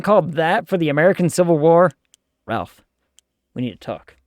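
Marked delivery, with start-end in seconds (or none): 2.4-4.2 mock serious talk. Mock scolding the scriptwriter.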